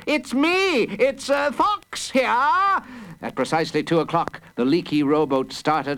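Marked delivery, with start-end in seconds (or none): 0.1-2.8 high, panicky identification; terrified pleading. A narrator's reading. Mister Fox telling the gunners who he is, terrified. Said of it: "pleaded a terrified Mister Fox".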